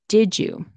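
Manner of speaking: "Did you?" with D and Y blended into a J, "didja". Shown as a demonstration. Teacher showing students how "Did you?" becomes "didja". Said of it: "Y sound turns into a J sound".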